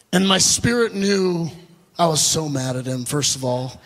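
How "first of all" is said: usual speaking voice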